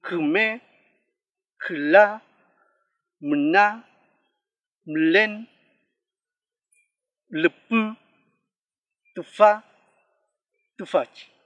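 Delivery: "kume, kla, mna, mlen, lepu, tufa, tufachi" projected, long pause after each; vowel after consonant. Shown as a demonstration. Final review for learners, loud word-by-word demonstration. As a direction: model "kume" through "tufachi", sixth vowel melting into the preceding consonant. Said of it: "Cuando va después de una consonante".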